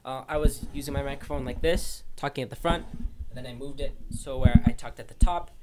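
ordinary talk, nothing marked

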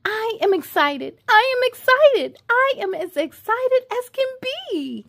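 squealing storybook excitement; excited. Acted. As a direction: bounce up with delight. Excited refrain, louder, higher.

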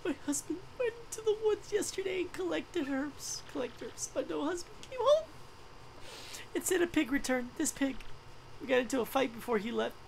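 high, whispered tearful storytelling; a heartbroken wife, half-whispering through tears; crying widow. Acted. Widow tells traveler about husband, high whispered crying.

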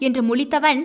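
plain reading voice

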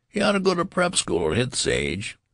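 very high-pitched mocking voice; jeering heckler. A narrator's reading. Someone in the crowd heckling Benjamin, mockingly. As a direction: taunt him in a squeaky-high pitch.

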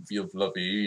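ordinary delivery

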